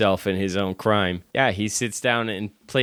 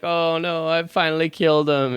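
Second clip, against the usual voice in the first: high, half-hearted mock sob; hammed-up tearful cry; 8 semitones higher, 3 dB louder than usual. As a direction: fake a weepy wail, pitched up. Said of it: "She's crying".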